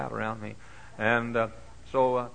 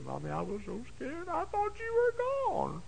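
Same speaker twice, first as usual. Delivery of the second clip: put-on small child relieved. Boy hugging his mother after being lost, high child voice. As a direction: sound like a scared little boy.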